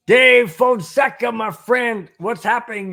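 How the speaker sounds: drawn-out, high, loud hail to a friend; slow, loud, high welcome across the room; excited call-out. Real, not acted. Greeting a chat viewer by name.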